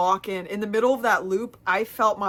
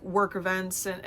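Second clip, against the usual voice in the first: normal talking voice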